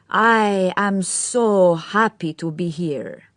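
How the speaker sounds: every syllable emphasised, slow and gushing; spoken through a smile, savouring each word; affected young man. A narrator's reading. Cedric to the Mondors.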